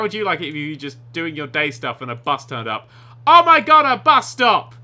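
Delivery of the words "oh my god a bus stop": loud, high exclamation; gleeful astonished cry; excited discovery. Real, not acted. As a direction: shout in thrilled surprise. Sudden discovery in game.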